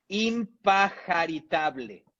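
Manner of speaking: impajaritable drawn out slowly; slow enunciation. Shown as a demonstration. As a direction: present impajaritable slowly, syllable by syllable. Announces an unusual word, then enunciates.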